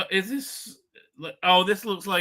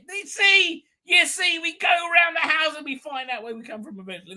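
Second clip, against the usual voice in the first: excited movie quote; 11 semitones higher than usual. Retold or imitated. Quoting a movie line excitedly.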